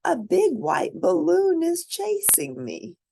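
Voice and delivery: high, panicky report; alarmed storybook voice; scared man. A narrator's reading. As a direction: tell it like something is chasing you.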